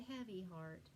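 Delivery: ordinary delivery, nothing marked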